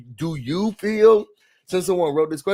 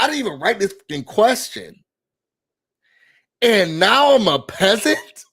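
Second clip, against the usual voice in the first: usual speaking voice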